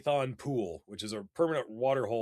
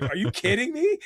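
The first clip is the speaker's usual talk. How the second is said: high, loud squeal of disbelief; reliving the moment of spotting the snake; thrilled disbelief; 9 semitones higher, 7 dB louder than usual. Retold or imitated. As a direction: shriek it like you can't believe your luck. Retelling finding the rare python.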